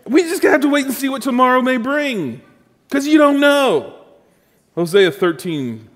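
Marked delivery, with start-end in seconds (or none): 0.1-3.9 mother's voice. Retold or imitated. Preacher repeating mom's line, high and hammed-up. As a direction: ham up the mother's stock answer. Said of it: "And she would say".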